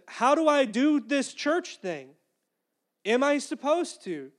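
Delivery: loud, high, stretched-out plea; silly exasperation. Retold or imitated. Preacher pleading with God for practical answers, comically exasperated. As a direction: press the question with comic impatience. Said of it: "saying this kind of like, you know, s-, in a silly way".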